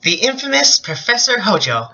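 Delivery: high, theatrical character read; overplayed dramatic delivery; hammy stage actor. Reading a character line aloud.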